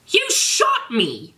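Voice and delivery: high, slow outraged accusation. Acted. Draco accuses Garfield, high slow outrage. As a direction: drag out the outrage.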